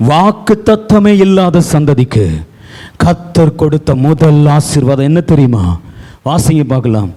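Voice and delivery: ordinary delivery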